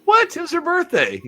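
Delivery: high, loud mock shock; teasing a friend with high, loud mock disbelief; mock outrage. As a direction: feign shock that he refused her.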